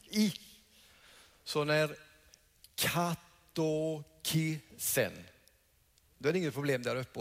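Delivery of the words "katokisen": katokisen said very slowly, the vowel as a clear i; slow demo of katokisen with i; i pronunciation. Shown as a demonstration. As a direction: stretch out katokisen, leaning on the i sound. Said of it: "uttalas som i".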